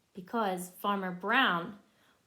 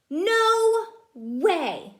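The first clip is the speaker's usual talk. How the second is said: loud, higher-pitched stern no; gruff refusal; 8 semitones higher, 9 dB louder than usual. Acted. Farmer Brown refuses the cows, loud and cross. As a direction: slam the door on the idea. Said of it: "said Farmer Brown".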